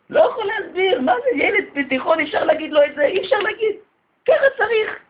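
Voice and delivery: high, near-tearful disbelief; exasperated outburst. Real, not acted. Rabbi pleading with the congregation, high and exasperated. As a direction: plead with the parents, voice breaking high.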